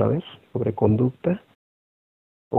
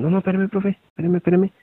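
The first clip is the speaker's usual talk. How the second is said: high-pitched pupil pleading for time; urgent high-voiced student plea; imitated student; 8 semitones higher than usual. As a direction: mimic a student stalling the teacher, pitched up. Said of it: "ya hasta dicen".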